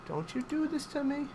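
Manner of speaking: soft, high pleading whine; high-pitched plea. Real, not acted. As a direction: plead quietly in a small high voice. Pleading again, rising pitch.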